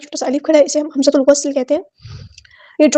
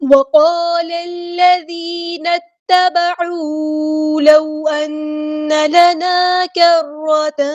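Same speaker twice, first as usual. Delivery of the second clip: Quran recitation; much slower than usual. Acted. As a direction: recite with tajweed, drawn out and sung. Recites next verse. (تجوید کے ساتھ آہستہ، سریلی تلاوت)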